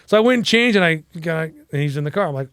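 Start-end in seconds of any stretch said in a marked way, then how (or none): none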